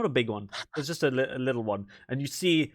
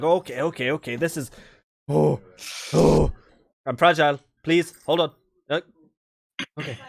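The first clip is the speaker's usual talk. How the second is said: rising, louder panicked gasps while being crushed; squeezed in a hug; 4 semitones higher, 8 dB louder than usual. Acted. Corfell squished in a bear hug, panicking loud. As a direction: squeak it out as the hug crushes him.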